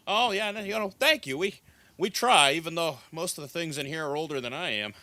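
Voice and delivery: high, loud, pleased thanks; chatty innkeeper, bubbling with gratitude; flattered barkeep. Acted. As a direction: accept a compliment in a lively rush. Barkeep thanks customer for compliment.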